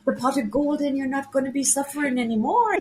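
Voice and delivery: a hammed-up Irish storyteller's lilt; poor Irish accent. Storyteller soothing a girl in pain, in a poor Irish accent. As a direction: soothe a sick child in a put-on Irish brogue. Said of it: "poor Irish accent".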